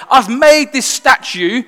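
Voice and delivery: a king roaring at defiance; angry king. Retold or imitated. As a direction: rage at them like a wounded tyrant. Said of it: "He's so angry".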